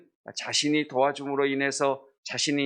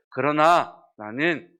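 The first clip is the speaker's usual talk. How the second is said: emphatic declaration; 2 semitones lower than usual. Acted.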